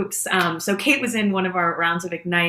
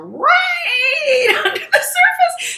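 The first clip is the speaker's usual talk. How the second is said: laughing through it; 6 dB louder, slower than usual. Real, not acted. Telling her cohost about her trauma, laughing high and cracked. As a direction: pitch jumps high, laughing and breaking.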